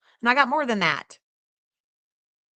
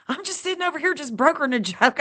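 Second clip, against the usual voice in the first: laughing embarrassed; 7 semitones higher, faster than usual. Real, not acted. Flustered by gifted money.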